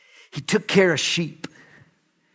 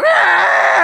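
high loud mock baby wail; high mock infant wail; baby wail imitation; 7 dB louder than usual. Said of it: "the little one would cry".

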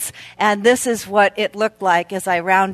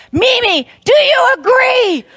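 put-on high, loud eager child asking; much higher, louder boyish question; little boy's voice; 12 semitones higher, 5 dB louder than usual. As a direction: voice the little grandson, insistent. Grandson Seth voiced asking.

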